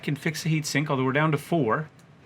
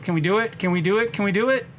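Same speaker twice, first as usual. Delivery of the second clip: tense rising urging; 6 semitones higher, faster than usual. Real, not acted. Tense escape, rising pitch.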